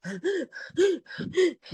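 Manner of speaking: high gasping sob intake; mock crying gasp. Shown as a demonstration. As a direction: demo a girl's hyperventilating sob. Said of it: "something crying, crying, something like that".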